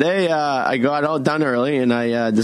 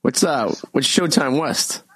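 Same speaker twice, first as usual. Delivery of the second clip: rough, tired morning-after voice; hungover voice; 4 semitones lower, 3 dB quieter than usual. Real, not acted. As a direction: sound worn out from last night. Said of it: "I still have the hungover voice".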